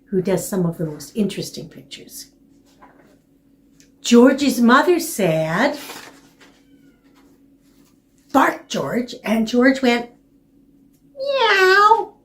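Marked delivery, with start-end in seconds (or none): 4.0-10.1 lively storytime voice; reading to a toddler. A narrator's reading. Reading picture book to children. 11.1-12.2 high cat meow. Acted. Dog says meow in story.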